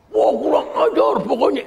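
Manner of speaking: a hammed-up angry wife cursing; mock outburst. Angry wife blames husband without asking why, mock outburst. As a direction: play the blindly angry spouse, lightly. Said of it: "Bukan emosi tanpa juntrungan".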